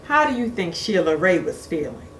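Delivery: ordinary delivery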